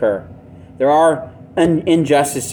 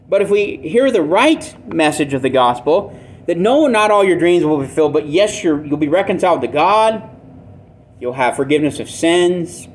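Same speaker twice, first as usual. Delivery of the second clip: ordinary delivery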